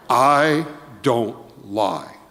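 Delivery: very slow, deliberate denial; slow calm denial. Retold or imitated. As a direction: say it slowly like it settles everything. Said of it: "now John has this way".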